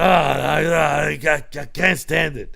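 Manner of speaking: loud stammering growl, slowing down; hammed-up sputter of exasperation; imitated Vince. Host imitating Vince giving up, mock exasperated sputter. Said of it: "He'd be like".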